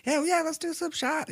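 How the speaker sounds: very high, mock-eager voice; feigned enthusiasm. Reluctant teen agreeing to shots, feigned enthusiasm. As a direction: squeak out fake keenness. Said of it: "and I'm like".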